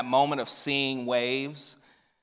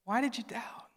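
gentle question; 10 semitones higher, 7 dB quieter than usual. Retold or imitated. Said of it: "his attitude is not that at all".

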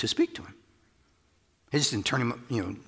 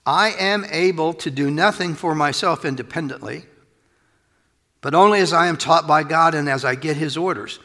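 ordinary talk

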